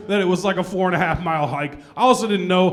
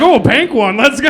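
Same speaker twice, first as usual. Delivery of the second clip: high, loud, pumped up; gleeful; 7 semitones higher, 7 dB louder than usual. Retold or imitated. Finding a Starburst in the washer, loud and glad. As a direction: celebrate finding candy. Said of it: "I was like".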